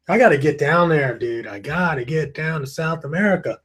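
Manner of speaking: normal talking voice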